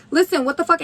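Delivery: mildly heated re-enacted outburst; pissed off. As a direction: confront him, fed up. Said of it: "So now I'm pissed".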